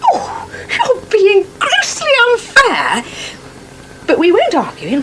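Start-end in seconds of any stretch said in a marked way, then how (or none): 0.0-3.0 slow, wounded, near tears; hurt protest. Acted. Wife hurt by husband's dig about polish, slow wounded whine. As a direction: drag it out like she's been stung.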